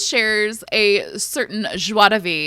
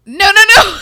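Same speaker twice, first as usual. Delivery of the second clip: loud protest; 10 semitones higher, 10 dB louder, faster than usual. Retold or imitated. Protesting retold to husband.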